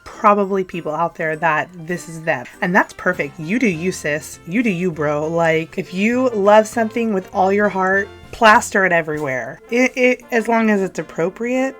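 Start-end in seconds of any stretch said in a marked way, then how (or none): none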